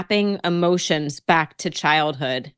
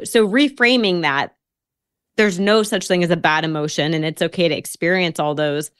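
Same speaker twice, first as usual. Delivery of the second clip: ordinary delivery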